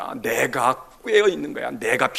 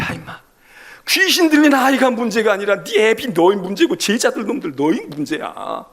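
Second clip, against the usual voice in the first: exasperated Jesus; 6 semitones higher, 7 dB louder than usual. Acted. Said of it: "예수님이 그게 답답한 거야".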